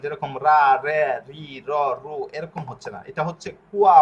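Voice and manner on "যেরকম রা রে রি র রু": রা রে রি র রু sounded out one by one, each a single loud syllable; louder syllable demo; syllable drill. Shown as a demonstration. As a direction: drill রা, রে, রি, র, রু slowly as separate beats. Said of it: "এক অক্ষরের উচ্চারণ".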